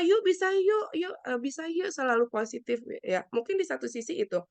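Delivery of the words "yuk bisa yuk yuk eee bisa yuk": peppy encouragement. Acted. Helper pushing a sufferer to stay positive, peppy cheering. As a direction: imitate a chirpy pep talk. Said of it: "memberikan semangat ya".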